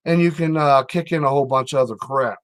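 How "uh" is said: normal talking voice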